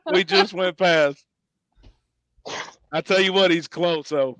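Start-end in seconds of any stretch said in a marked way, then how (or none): none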